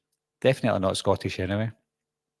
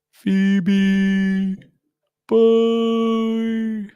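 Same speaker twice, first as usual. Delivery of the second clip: high, drawn-out wailing call; mock sad farewell; 12 semitones higher, 5 dB louder than usual. Host re-enacting the film's sad ending, mock-wailing farewell. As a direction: call out a long, louder, hammed-up goodbye.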